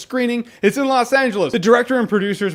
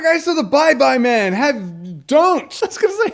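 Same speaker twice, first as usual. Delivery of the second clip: usual speaking voice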